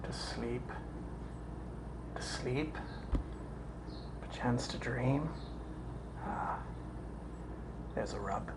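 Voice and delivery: quiet, halting soliloquy; hushed musing. Acted. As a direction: think it aloud, very softly. Hamlet sinks into private musing.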